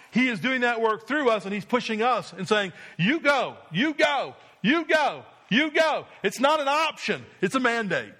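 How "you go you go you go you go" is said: each "you go" climbs higher; building urgent command. Acted. Preacher urges the congregation forward.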